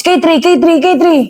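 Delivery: retold shout. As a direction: call out the gun name like a sergeant yelling under fire. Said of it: "Sumisigaw si Sergeant Formanes".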